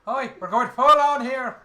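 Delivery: very loud, high, slow Irish brogue; Hollywood Irish. Acted. Game master hamming up the accent for players, Hollywood Irish. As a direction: belt it out in over-the-top Irish. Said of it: "This is what I call Hollywood Irish".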